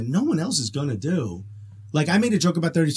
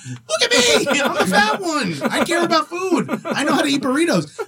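much higher and laughing all through, mock boasting; laughing self-mockery; 14 semitones higher, 4 dB louder than usual. Host retelling the burrito argument to his cohost, laughing high-pitched bragging. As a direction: crack up while bragging about being the food guy. Arguing about burrito eating.

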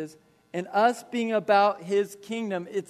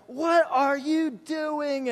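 high, slow, drawn-out mock yell; incredulous parental outburst, pitched way up; freaking-out dad; 7 semitones higher, slower than usual. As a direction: imitate an outraged father, stretching every word. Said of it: "He just starts freaking out".

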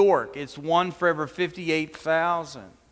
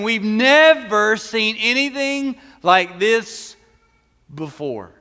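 louder, slow, amazed proclamation; amazed praise; 3 semitones higher, 4 dB louder, slower than usual. Acted. Pastor voicing the amazed crowd praising God, loud and slow. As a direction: proclaim it like the crowd that just saw a miracle.

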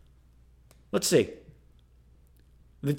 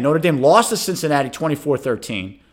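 ordinary delivery

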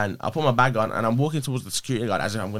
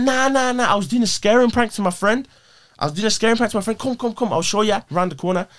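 high-pitched hasty protest of innocence; pleading excuse; 9 semitones higher than usual. Retold or imitated. Telling police it's a prank on my friend, retold pleading excuse. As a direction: talk your way out of it, voice pitched up.

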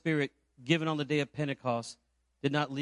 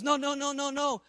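high-pitched, louder string of protests; retold excitement; 10 semitones higher, 4 dB louder than usual. Excited convert stopping the pastor leaving, retold animatedly. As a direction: imitate an excited man refusing to let you go. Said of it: "He's so excited".

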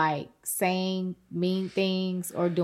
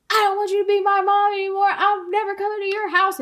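put-on very high, louder child's tantrum voice; whiny little kid; 12 semitones higher, 5 dB louder than usual. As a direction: play the five-year-old throwing a fit. Godson quote again, angrier.

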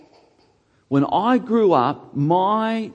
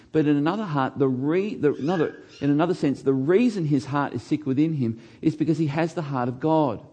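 normal talking voice

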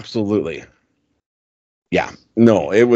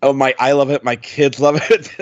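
ordinary talk, nothing marked

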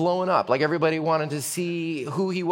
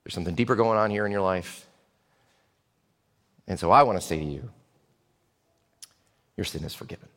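much lower and softer, calm; gentle Jesus; 9 semitones lower, 5 dB quieter, faster than usual. Acted. Jesus to the paralyzed man, soft and gentle. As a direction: answer tenderly and quietly. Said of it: "And Jesus is like".

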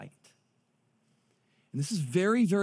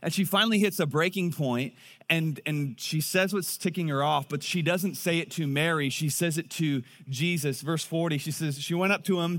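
ordinary talk

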